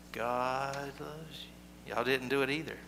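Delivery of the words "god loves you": soft, slow, drawn-out hush; just above whisper. Shown as a demonstration. Leading children in telling the congregation, slow and hushed. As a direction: say it barely louder than a whisper. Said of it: "just a little bit louder than a whisper".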